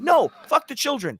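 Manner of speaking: high, heated refusal; vehement rejection. Acted. Rejecting the plea to let children in, vehement and high. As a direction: cut down the argument angrily.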